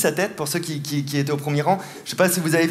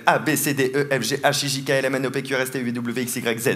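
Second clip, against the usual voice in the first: alphabet droned low, trailing softer; low monotone recital; 5 semitones lower, 4 dB quieter than usual. Real, not acted. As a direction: reel off letters evenly, sinking lower. Égrène l'alphabet pour lire l'esprit. (récitation grave et monotone)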